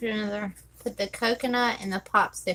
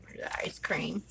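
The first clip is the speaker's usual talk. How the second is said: whispered; 3 semitones lower, 8 dB quieter than usual. Real, not acted. Muttering while placing sticker.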